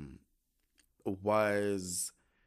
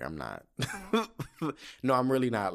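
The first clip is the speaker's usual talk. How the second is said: ordinary delivery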